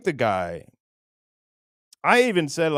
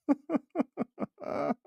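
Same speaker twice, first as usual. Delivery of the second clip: laugh; 13 semitones higher, 6 dB quieter than usual. Real, not acted. Laughs at his own bombed bit.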